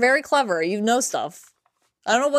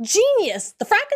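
mock excited; 12 semitones higher, 3 dB louder, slower than usual.

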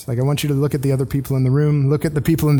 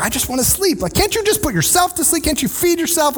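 exasperated parent; 11 semitones higher than usual. Retold or imitated. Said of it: "you're like".

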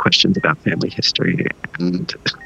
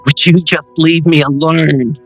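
angry; 7 semitones higher, 4 dB louder than usual. Acted. Drake snaps at ghost.

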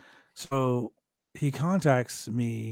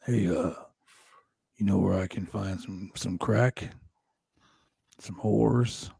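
low, halting, shady request; low-voiced wrestler asking on the sly; Jake the Snake Roberts; 6 semitones lower, 4 dB quieter, slower than usual. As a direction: lower your pitch and ask haltingly. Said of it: "so Jake Roberts goes to my wife".

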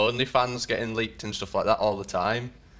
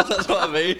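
laughing; 8 semitones higher, 4 dB louder than usual. Real, not acted. Laughing along with Rob.

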